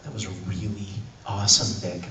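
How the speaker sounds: soft, low, careful praise; hushed director's praise. Retold or imitated. Director to actor after an emotional take, hushed praise. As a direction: say it softly, as if moved.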